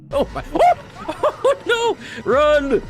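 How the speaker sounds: high, loud panicked yelling; real panic, laughing while fleeing; being chased. Real, not acted. Panicking as monsters chase him.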